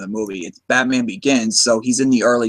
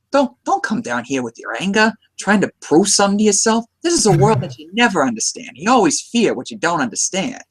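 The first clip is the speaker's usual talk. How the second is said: put-on New York accent; 6 semitones higher than usual. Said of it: "when I try doing it".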